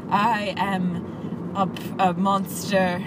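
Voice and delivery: slow faux British accent, imitating a movie star; slow, mock movie-star British accent. Imitating the film's lead actor, slow fake British accent. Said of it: "kind of a movie star British accent".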